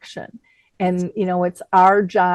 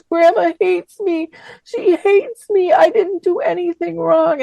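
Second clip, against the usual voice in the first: imitated tearful wail, much higher; 11 semitones higher, 4 dB louder than usual. Daughter running to mom after grandma's outburst, imitated tearful voice. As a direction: wail it high like a hurt, crying teenager. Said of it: "her lips quivering, and she's just got tears streaming".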